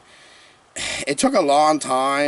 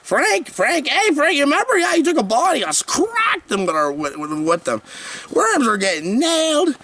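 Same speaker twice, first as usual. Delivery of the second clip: giddy reminiscing; 12 semitones higher than usual. Acted. Gleeful volleyball memory, high.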